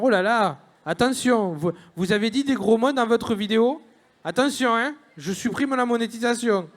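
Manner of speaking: put-on lively southern French Toulouse accent; mock YouTube official in a Toulouse accent. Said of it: "j'imagine que YouTube parle comme ça, qu'ils aient un accent toulousain".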